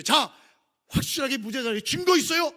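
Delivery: a courtroom shout in the sermon; shouted challenge. Acted. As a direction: demand the proof like a prosecutor. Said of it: "라고 외치는 것과 똑같은 거예요".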